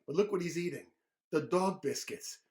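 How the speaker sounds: plain reading voice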